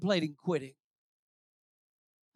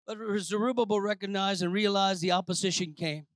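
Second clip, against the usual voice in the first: normal talking voice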